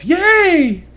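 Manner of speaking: high, loud mock cheer; a drawn-out, belated hooray; delayed celebration. Imitating her late cheer after the race, celebrating. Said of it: "super delayed celebration".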